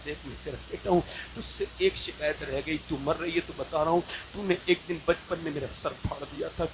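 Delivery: quietly grieving son at a deathbed; softly crying complaint. Retold or imitated. Son telling his dying mother an old hurt, soft and tearful. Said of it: "मैंने रो के कहा".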